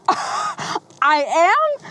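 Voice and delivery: high surprised I am; surprised disbelief. Retold or imitated. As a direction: squeak it out in disbelief. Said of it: "It's like".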